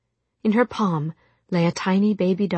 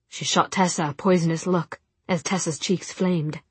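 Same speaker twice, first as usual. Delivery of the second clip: usual speaking voice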